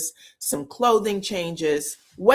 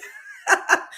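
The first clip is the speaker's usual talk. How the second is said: high breathy laugh. Real, not acted. Laughs after the mock outburst.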